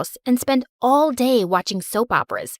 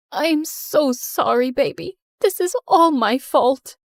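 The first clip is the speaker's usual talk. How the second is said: slow, shaky regret at a bedside; mother sorry at her injured daughter's bedside, slow and tearful; tearful apology; 3 semitones higher, slower than usual. Acted. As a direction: plead for forgiveness, slowly. Mom apologizes at hospital bed.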